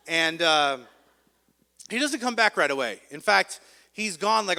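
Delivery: ordinary delivery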